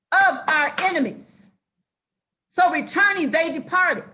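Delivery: loud, high hollering sermon. Real, not acted. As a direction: holler it at the congregation. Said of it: "I'm sorry for hollering".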